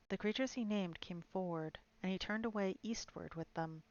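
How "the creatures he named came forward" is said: plain reading voice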